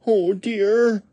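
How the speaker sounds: high-pitched woodchuck moan. A narrator's reading. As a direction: moan it in dismay. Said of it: "moaned the woodchuck".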